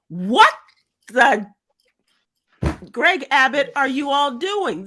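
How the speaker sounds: loud, incredulous outburst; outraged. Real, not acted. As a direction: demand an answer in disbelief. Outraged at Texas bill.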